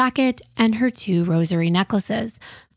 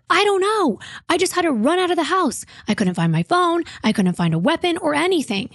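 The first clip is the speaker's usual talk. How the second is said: retold yelling; 8 semitones higher than usual. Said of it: "He's yelling at the dispatcher".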